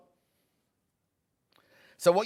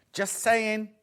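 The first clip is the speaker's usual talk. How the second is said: light, raised playful nudge; teasing just saying; 4 semitones higher, 3 dB louder than usual. Real, not acted. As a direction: tease the buyer with it. Teasing sales nudge repeated.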